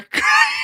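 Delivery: sudden high-pitched burst of laughter. Real, not acted. Laughs at remembering the actor.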